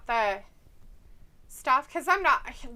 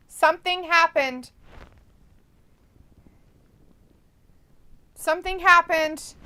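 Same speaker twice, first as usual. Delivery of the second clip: louder, higher announcement; excited; 6 semitones higher, 11 dB louder than usual. Real, not acted. Streamer telling chat about the Olympics, loud and excited. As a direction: build it up with rising alarm.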